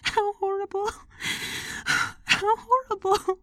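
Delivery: a grieving woman's high cry of despair; tearful. Acted. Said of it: "burst into tears".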